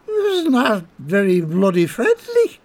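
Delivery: high, slow grumble; grumbling drunk. Acted. Colm grumbling round the corner, a touch high. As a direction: sulk it to yourself. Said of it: "he grumbled to himself".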